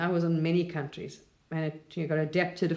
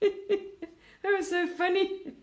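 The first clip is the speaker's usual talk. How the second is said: high, helpless laughter through the words; laughing; 13 semitones higher, much slower than usual. Real, not acted. Speaker amused by own story about Source, laughing.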